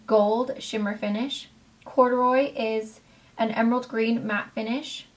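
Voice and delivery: normal talking voice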